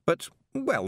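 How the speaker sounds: ordinary delivery, nothing marked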